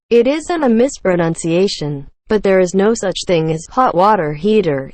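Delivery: ordinary talk, nothing marked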